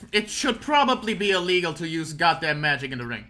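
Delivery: ordinary talk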